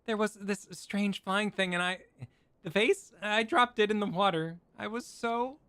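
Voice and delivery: very high, nervous servant; squeaky maid voice. Acted. Maid's high squeaky excuse.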